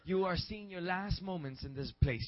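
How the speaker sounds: loud, rousing preacher voice; preacher proclaiming to the church; full-force preaching. Acted. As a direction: lift the congregation with praise. Preaching to congregation.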